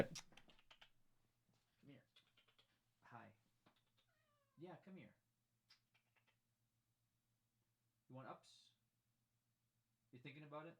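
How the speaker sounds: soft, gentle coaxing to a pet; sweet-talking her into being picked up; coaxing the cat. Real, not acted. Streamer gently coaxing the cat up.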